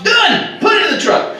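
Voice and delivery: sudden high burst of triumph; triumphant shout. Retold or imitated. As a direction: crow the win like you just nailed it. Hits the box, triumphant.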